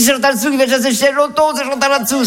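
high, hurried mock holler; imitated yelling. Man announcing the visitor to his household, high mock yell. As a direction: shout the news through the flat, high-pitched. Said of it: "Il a hurlé, il était au fond".

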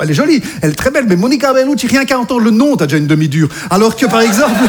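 normal talking voice

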